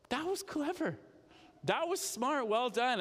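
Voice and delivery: high-pitched, amazed admiration; impressed commendation. Acted. Master commends his dishonest manager for shrewdness, impressed. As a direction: play a boss impressed despite himself. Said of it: "he doesn't commend him for his dishonesty".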